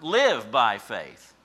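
live punched loud and slow; stress on live. Shown as a demonstration. Preacher to congregation, naming live the key word. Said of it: "here's the key word".

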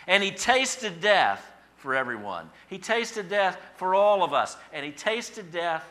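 high, loud, slow emphatic preaching; impassioned preaching. Real, not acted. Preacher telling congregation of Christ's death, impassioned. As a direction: drive each repetition home at full voice.

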